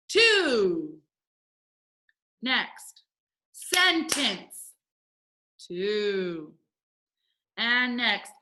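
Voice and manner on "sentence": "sentence" high, as sen-tence; syllable by syllable. Shown as a demonstration. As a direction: beat out both syllables of "sentence". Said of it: "break it up by the vowel sound".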